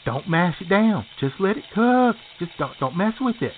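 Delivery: ordinary talk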